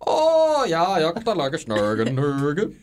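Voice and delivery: high bouncy mock Norwegian gibberish. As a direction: play a cartoon Norwegian. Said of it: "Norwegian voice".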